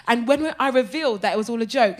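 usual speaking voice

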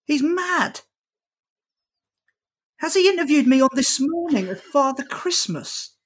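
high, baffled outburst; retold disbelief. Comedian recalling his reaction to the host, high baffled outburst. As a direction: raise the pitch in bewildered disbelief. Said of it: "I was like".

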